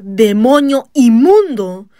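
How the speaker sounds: slow, loud, furious rebuke of the demon; shouted rebuke. Retold or imitated. Preacher shouting at the demon in his mother, furious. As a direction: bellow it with all your fury. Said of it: "y grité".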